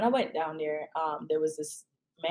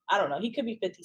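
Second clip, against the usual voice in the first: ordinary delivery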